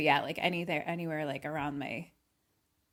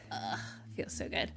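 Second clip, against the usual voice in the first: groaning pleasure; 9 semitones lower, 12 dB quieter than usual. Acted. Savoring a butt massage.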